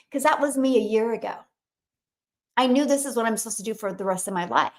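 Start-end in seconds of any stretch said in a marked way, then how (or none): none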